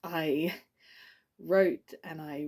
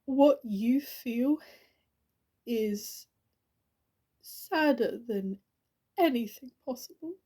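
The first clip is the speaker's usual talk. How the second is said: higher-pitched, halting, understated grief; grieving; 5 semitones higher than usual. Acted. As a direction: hold back grief at a friend's funeral. Eulogy for dead friend, grief rising.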